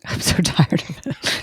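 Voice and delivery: a worn-out chuckle through the words; tired laugh. Real, not acted. Weary mom laughing to the host about her traumas.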